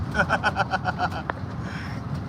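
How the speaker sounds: amused chuckling; giggling laugh. Real, not acted. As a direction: run of quick, breathy chuckles. Amused by own story.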